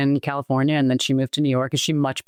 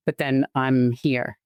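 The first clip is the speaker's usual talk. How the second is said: ordinary delivery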